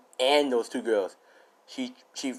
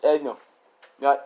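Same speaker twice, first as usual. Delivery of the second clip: ordinary delivery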